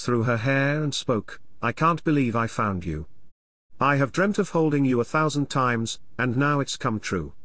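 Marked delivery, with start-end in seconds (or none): none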